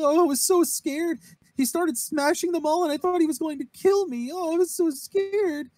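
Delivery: high-pitched, hammed-up scared voice; exaggerated high "so scared" act; mock frightened damsel. Acted frightened damsel running into arms.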